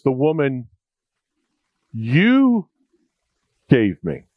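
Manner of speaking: accusing emphasis on "you"; stress on you. Shown as a demonstration. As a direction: lean hard on "you" after a pause, then drop on "gave me". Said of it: "Now listen to what he says".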